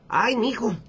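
put-on high-pitched old granny; abuelita. Grandma answering her husband with the punchline, high old-lady voice. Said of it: "¿Qué te pareció, mija?".

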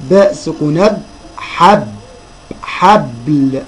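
teacher demonstrating a closed ب inside حبل; letter with sukun. Shown as a demonstration. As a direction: sound out vowelless ب, then حب, then حبل slowly. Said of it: "الباء هتتنطق ازاي في كل كلمة".

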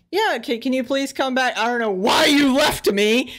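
a hammed-up sweet-talking girlfriend begging him back; mock sweet girlfriend. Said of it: "she's talking so sweet".

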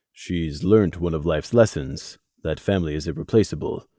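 a quiet inner voice, unhurried; silent message. A narrator's reading. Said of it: "was Crimson's silent message".

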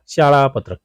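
ordinary talk, nothing marked

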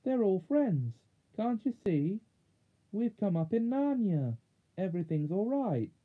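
high-pitched, eager reassurance; urgent crying out. A narrator's reading. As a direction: call out the good news, pitched high. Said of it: "cried Jill".

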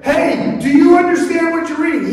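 high, loud hail and question; calling out. Acted. Philip runs next to the chariot asking the reader, calling out. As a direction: shout across to a passing rider.

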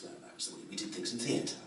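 ordinary talk, nothing marked

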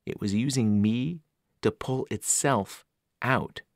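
usual speaking voice

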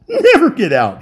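high, loud laughing yell; the stranger yelling hysterically at the crew. Acted. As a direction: shriek it with mad glee. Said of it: "he yelled".

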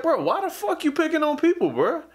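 high, exasperated retold scolding; retold indignant call-out. Speaker scolding a gym bully, high and exasperated.

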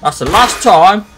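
loud fed-up yell; furious shout. Real, not acted. Gamer yells at an enemy who hit him, furious shout. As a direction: shout it, done being hit. Angry at being hit again.

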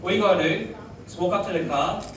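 ordinary delivery

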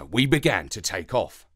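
ordinary delivery

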